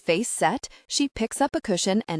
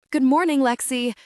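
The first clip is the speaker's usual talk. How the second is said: loud, higher-pitched sudden greeting; startling greeting; 6 semitones higher, 5 dB louder than usual. A narrator's reading. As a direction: call out brightly enough to startle. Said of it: "A voice makes me practically jump".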